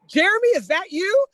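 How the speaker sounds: high, loud call of recognition; surprised recognition. Retold or imitated. Friend spots the officer she knows, surprised recognition. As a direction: imitate a friend calling out in surprise.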